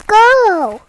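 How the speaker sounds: plain reading voice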